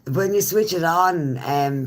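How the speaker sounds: ordinary talk, nothing marked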